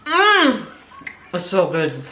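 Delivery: talking with a mouth full. Real, not acted. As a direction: say it through a bite of crepe. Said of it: "talk when you have your mouth full".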